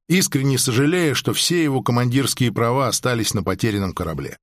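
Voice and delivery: ordinary delivery, nothing marked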